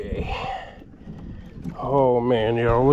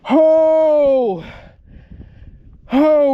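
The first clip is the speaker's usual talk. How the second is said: higher, louder thrilled exclamation; loud excited oh; 10 semitones higher, 6 dB louder than usual. Real, not acted. Angler hauling the catfish aboard, loud excited oh. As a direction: let the thrill burst out. Landing the big fish.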